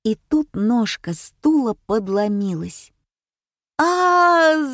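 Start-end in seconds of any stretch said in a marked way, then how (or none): 3.8-4.7 loud high drawn-out "aaah"; startled wail. A narrator's reading. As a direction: yelp in alarm while falling. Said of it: "завопила Женя". (испуганный вопль)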